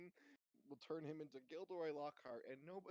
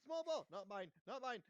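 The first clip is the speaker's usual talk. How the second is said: higher yappy puppy voice; excited dog voice; 7 semitones higher, 4 dB louder than usual. Pretend. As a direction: chirp it like a happy dog. Dog voice continues.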